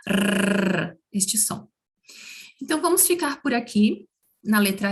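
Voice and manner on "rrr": rrr like a nervous little dog growling; rolled r. Shown as a demonstration. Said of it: "imitando um cachorrinho nervoso".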